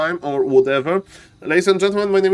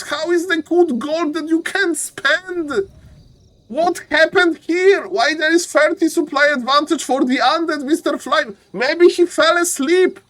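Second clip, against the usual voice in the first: excited commentary; 10 semitones higher than usual. Real, not acted.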